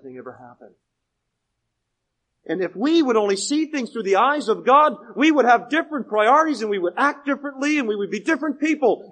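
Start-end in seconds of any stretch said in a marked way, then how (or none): 2.5-9.1 loud, higher-pitched sermon proclamation; forceful preaching. Real, not acted. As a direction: proclaim it to the whole congregation.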